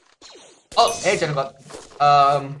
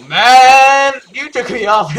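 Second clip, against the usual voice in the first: excited yell; 7 semitones higher, 11 dB louder, slower than usual. Real, not acted. Gets launched into the sky.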